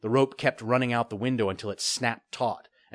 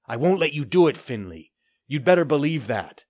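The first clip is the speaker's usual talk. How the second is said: put-on a young lad standing up to a pirate, louder; defiant boy; 6 semitones higher, 7 dB louder than usual. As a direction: push back hard at the pirate.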